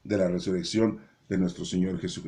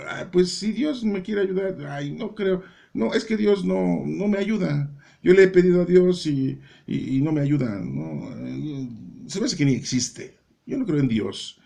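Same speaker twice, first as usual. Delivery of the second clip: a mock whiny doubter, pitched up; doubting believer; 7 semitones higher than usual. Preacher mimicking believers who say God won't help, mock whiny. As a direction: lightly play the faithless complainer. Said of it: "Muchas veces nosotros decimos".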